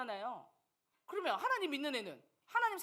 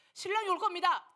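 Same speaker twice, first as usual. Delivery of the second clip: announcing call; 6 semitones higher, 6 dB louder than usual. Acted. Parable voice calling out.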